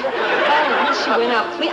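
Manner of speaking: ordinary talk, nothing marked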